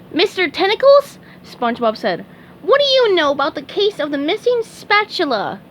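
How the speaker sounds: very high, eager cartoon sponge voice; high squeaky sponge voice; SpongeBob voice. A narrator's reading. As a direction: chirp it like a keen little fry cook. SpongeBob speaks.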